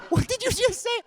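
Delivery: very high, loud mock disbelief; hammed-up shock at a rude word; shocked friends. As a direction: squeal it out in disbelief. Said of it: "My friends were like".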